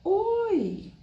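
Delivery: long, high-pitched "Oi" that swoops down; expressive greeting. Shown as a demonstration. Teacher greeting the person in front of her, high drawn-out "Oi". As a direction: stretch "Oi" high, greeting someone dear. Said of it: "Olha o que eu fiz".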